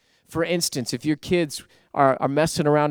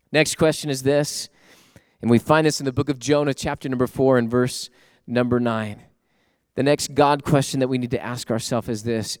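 usual speaking voice

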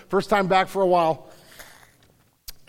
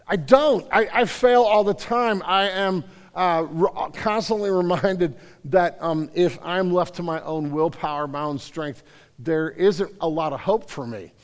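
ordinary talk, nothing marked

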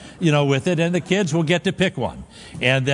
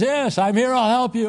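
lightly voiced God, raised and calm; 7 semitones higher than usual. God answering the stranded climber, lightly voiced and reassuring. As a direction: play God answering, steady and assuring. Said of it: "Voice says".

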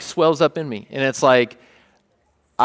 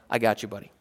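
softer and a bit lower, reassuring; tender reassurance; 4 semitones lower, 6 dB quieter, faster than usual. Retold or imitated. Dad telling his sick son he's there, tender. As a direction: say it like a quiet promise.

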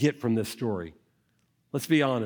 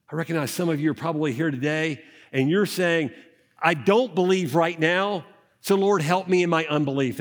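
louder and higher, stressing each "do"; passionate preaching; 3 semitones higher, 4 dB louder than usual. Acted. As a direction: preach it with full conviction. Preaches at full force.

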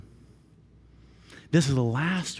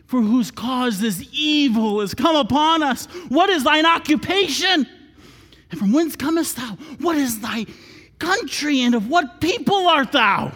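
loud, high, pressing questions to the stranger; urgent pleading; 9 semitones higher, 5 dB louder than usual. Acted. Mariners question Jonah in the storm, loud and urgent. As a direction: demand answers from Jonah in fear. Preacher voicing the frightened mariners.

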